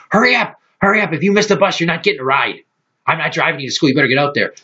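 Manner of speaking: high, fast hurrying mother; mock nagging mom. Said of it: "she'd stand over like".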